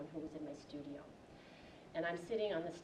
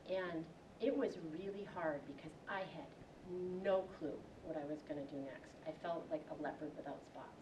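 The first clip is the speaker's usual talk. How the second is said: normal talking voice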